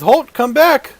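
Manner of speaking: high, loud guard shout; guard calling out. Acted. GM as guard shouting after the fleeing party. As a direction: call after them to stop.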